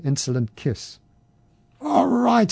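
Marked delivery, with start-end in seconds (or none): 1.8-2.5 holler. A narrator's reading. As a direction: yell it, ready to fight. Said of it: "he hollered".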